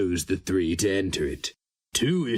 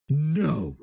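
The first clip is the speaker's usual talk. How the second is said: sharp higher cry of denial; 8 semitones higher than usual. A narrator's reading. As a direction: fling out the refusal. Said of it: "Sheo Narnra heard himself cry".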